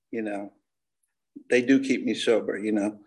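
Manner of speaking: usual speaking voice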